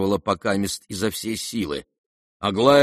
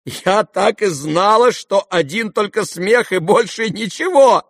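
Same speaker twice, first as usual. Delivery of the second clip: high, loud, slow exclamation; crying out; 11 semitones higher, 6 dB louder than usual. A narrator's reading. Sister exclaims after the laughing fit, crying out. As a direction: cry out that you knew it. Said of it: "вскричала Аделаида".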